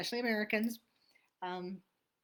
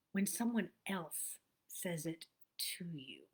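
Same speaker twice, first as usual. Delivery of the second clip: soft, slow whisper; 3 semitones lower, 6 dB quieter, slower than usual. Real, not acted. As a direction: breathe the words like a secret. Whispered aside about affirmations.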